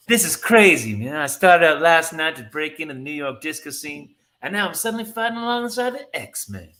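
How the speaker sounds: high, loud hippie-musician character voice; Muppet musician Dazzler. Acted. Disco singer suddenly fighting alongside X-Men, Muppet musician voice. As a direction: play it like a bewildered Muppet guitarist. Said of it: "what's that character from the Muppets?".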